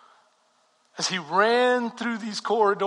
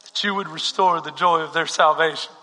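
hushed prayer; 3 semitones lower, 5 dB quieter than usual. Acted. Pastor praying over the congregation, soft and low. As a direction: pray quietly over the church.

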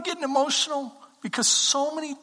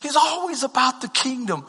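ordinary talk, nothing marked

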